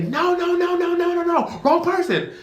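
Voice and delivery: very high repeated "no"; staff protesting. Retold or imitated. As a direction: stop the police tasing the wrong man. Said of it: "we all say".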